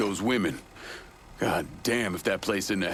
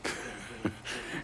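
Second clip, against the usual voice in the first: short laugh; 3 semitones higher, 10 dB quieter than usual. Acted. Man laughs at friend's remark.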